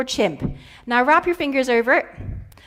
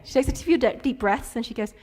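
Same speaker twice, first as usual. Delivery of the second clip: usual speaking voice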